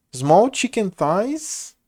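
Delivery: small drawn out slowly and leaned on; stress on small. Real, not acted. Telling viewers about the food, leaning on small. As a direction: stretch out small to show how tiny. Describing small chicken pieces.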